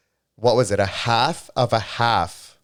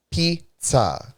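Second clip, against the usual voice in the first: "Pizza" in a high Italian-style pronunciation; Giada-style pizza; 6 semitones higher than usual. Retold or imitated. To viewers, mimicking Giada on TV: Italian-style pizza. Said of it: "Giada always says it like that".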